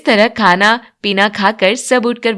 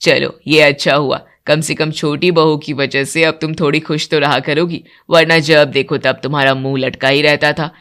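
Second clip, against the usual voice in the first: much lower, steady male voice; older man; 7 semitones lower than usual. Acted. Father and son praising the bride's cooking, much lower voice. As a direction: speak as the elder men of the house. Husband talks to wife.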